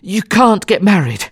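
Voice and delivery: high-pitched, winded urgency; out of breath. A narrator's reading. Passepartout breaking urgent news to Fogg, out of breath. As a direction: blurt it between gulps of air. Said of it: "he said out of breath".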